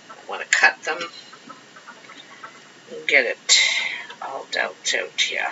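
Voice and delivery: soft whisper to herself; murmured whisper. Real, not acted. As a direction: whisper it under your breath.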